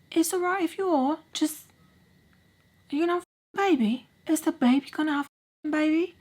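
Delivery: high-pitched put-on voice, halting; voiced stepdad. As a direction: imitate a stepdad gently prodding. Voicing stepdad asking about pregnancy.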